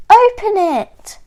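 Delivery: high, loud cheer to a friend; excited storybook chorus in one voice; eager urging. A narrator's reading. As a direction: egg him on excitedly. Animals urge George to open present.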